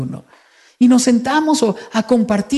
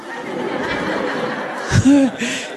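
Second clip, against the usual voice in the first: soft airy laugh; 6 semitones higher, 5 dB quieter than usual. Real, not acted. Laughs at father's signature.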